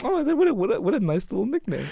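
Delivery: high, sweet mock-teacher voice; oblivious teacher. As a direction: coo it as a clueless teacher.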